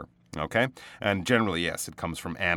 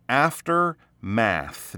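slow, stretched aftermath; slow demo; 3 semitones higher, 3 dB louder, much slower than usual. Shown as a demonstration. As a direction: say "Aftermath." slowly, syllable by syllable. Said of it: "you can slow it down a bit because it really is two words".

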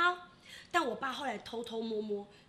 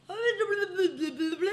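slow, slurred, higher and louder mumbling; stroke mumbling; 7 semitones higher, 7 dB louder than usual. Retold or imitated. Dad mid-stroke refusing milk, slurred slow mumble. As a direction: garble the words like a tongue that won't move. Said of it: "他就转过来说".

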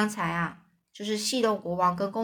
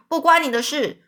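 put-on snapped out louder at the boy; mock roar; 5 semitones higher, 4 dB louder than usual. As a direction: bark it at Huck, hammed-up. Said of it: "又吼了一句". (公爵朝哈克吼：稍大声的呵斥)

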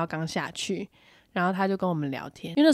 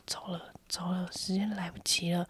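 whisper; 10 dB quieter than usual. Retold or imitated. Dad quietly urging to leave.

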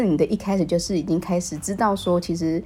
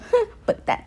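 much higher mock sneer; teasing scorn. Host imagining thinking of dad, high mock scoff. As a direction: scoff in a put-on voice.

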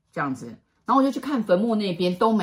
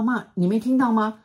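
ordinary delivery

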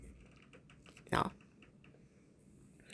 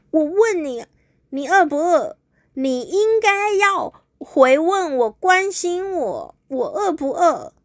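higher, slow, drawn-out complaint; petulant explaining; 8 semitones higher, slower than usual. Acted. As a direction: spell it out slowly like a sulking girlfriend. Girlfriend finally explains, upset.

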